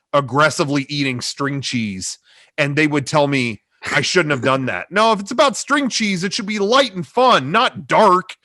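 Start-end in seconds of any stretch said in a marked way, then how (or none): 4.9-8.3 mock teacher voice. Teachers' advice voiced in retelling.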